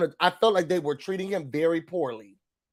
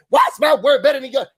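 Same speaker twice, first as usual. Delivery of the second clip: a loud, high, quick mock yell of the question; 5 semitones higher, 6 dB louder, faster than usual. Retelling the argument, mocking Cynthia's loud question. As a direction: throw the question back at her, loud and fast. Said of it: "now you yelling it".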